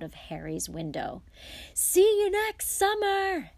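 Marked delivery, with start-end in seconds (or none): 1.7-3.5 high, drawn-out parting shout; yelled. A narrator's reading. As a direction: call it far back, stretching the last word. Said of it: "Harry yelled".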